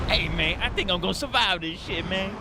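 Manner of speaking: a cartoonish high street voice.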